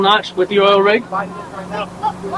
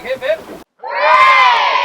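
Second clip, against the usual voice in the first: hip hip hooray cheer; much slower than usual. Real, not acted. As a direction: cheer rising to a shout. Cheer for the captain.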